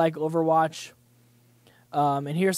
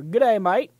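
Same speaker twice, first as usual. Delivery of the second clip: bad Australian accent; 3 semitones higher than usual. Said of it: "I'm shit at my Australian accents".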